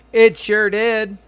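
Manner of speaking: very high, loud, slow and drawn out; milk it, full of yourself; smug drawl. Real, not acted. Smug answer to NPC.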